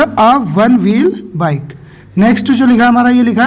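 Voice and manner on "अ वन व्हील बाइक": "अ" set before "one wheel bike", the phrase said slowly; slow model answer. Shown as a demonstration. Teacher models the corrected phrase slowly for the class. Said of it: "तो क्या आएगा".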